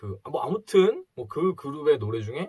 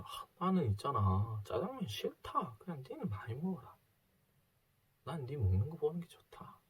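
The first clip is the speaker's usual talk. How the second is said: tender mother's voice; 2 semitones higher, 7 dB quieter than usual. Retold or imitated. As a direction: say it gently, hiding her own hunger. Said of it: "이렇게 얘기하시는 거죠".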